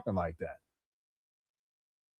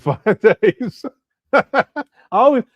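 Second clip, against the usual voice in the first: laughing; 7 semitones higher, 7 dB louder, much slower than usual. Real, not acted. Laughs reading joke super chat.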